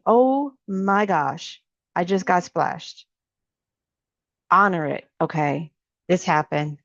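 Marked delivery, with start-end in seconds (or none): 0.1-3.1 very slow, letting the moment land; slow, mindful acknowledgement of the splash; staying with it. Shown as a demonstration. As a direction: stretch out "Oh my gosh" and stay with the feeling. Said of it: "staying with that".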